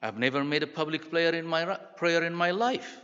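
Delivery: acted-out frightened reply; panicked refusal. A narrator's reading. Panicked reply in story read aloud.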